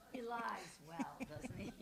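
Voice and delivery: slow, playful teasing aside; host joking to the audience about the guest, playful and slow. Real, not acted. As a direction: rib him gently for the crowd.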